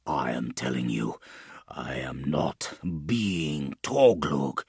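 low, slow hiss through pain. A narrator's reading. Pained man denying his old name, hissed. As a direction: force it out low, word by word. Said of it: "Taunus hissed".

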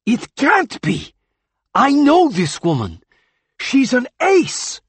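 high, loud, slow protest; heated disbelief. Acted. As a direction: refuse it outright. Heated hospital argument.

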